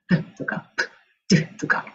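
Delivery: crisp, breathy English consonants "プッ" and "ドゥ"; whispered bursts of air on "プッ" and "ドゥ" like English sounds. Shown as a demonstration. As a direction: pop out "プッ" then "ドゥ" as English p and d. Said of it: "子音があるでしょ、独特な".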